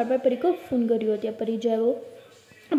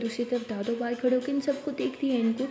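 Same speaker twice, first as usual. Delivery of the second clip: usual speaking voice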